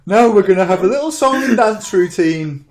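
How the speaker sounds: high, loud mocking announcer; sing-song send-up of a vain director; mocking showman voice. Mocking Tarantino's indulgence.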